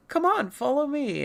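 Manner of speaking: high-pitched beckoning; urging character voice. Acted. Local leading the newcomer onward, urging high voice.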